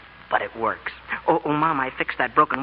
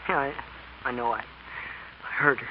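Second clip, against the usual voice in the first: teary and subdued; 3 semitones lower, 8 dB quieter, slower than usual. Acted. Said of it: "Have you been crying".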